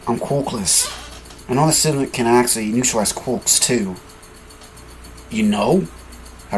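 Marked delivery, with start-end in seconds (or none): none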